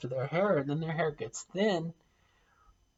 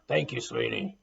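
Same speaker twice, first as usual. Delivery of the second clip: a lightly lowered, gruff take; 3 semitones lower than usual. Shown as a demonstration. Testing how sweetie sounds in a gruff voice, lightly lowered. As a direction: say it rough, not sweet. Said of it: "how does that sound?".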